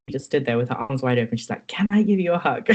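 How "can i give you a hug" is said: higher-pitched, welcoming imitation; lightly acted friendly eagerness; eager greeting. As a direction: offer it like an open-armed welcome. Acts out clinician's welcoming greeting.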